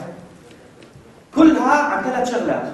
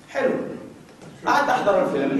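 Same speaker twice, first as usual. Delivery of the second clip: normal talking voice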